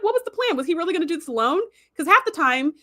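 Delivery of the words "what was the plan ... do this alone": much higher, fast disbelief; can't believe what she's seeing; incredulous. Retold or imitated. Fan questioning Sisko's plan, high and incredulous. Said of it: "So I was like".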